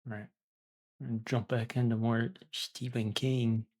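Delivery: ordinary delivery, nothing marked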